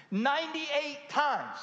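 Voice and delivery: Ninety-eight times drawn out slowly and high; emphatic count. Real, not acted. Preacher hammering the count to the congregation, slow and high.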